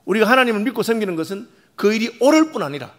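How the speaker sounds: a pastor preaching hard to his congregation; full-force preaching. Acted. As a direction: press the point home to the congregation.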